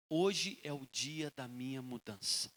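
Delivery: low, soft and slow; a hushed, deliberate vow to oneself; quiet resolve. Acted. Woman resolving to change today, quiet resolve.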